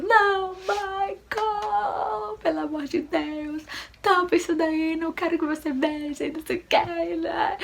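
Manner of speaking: high, drawn-out desperate wail; desperate, in pain. Retold or imitated. Wife in labour begging husband to cover her, desperate. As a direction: re-enact a desperate plea, pitched far up. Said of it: "Desesperada, morrendo de dor".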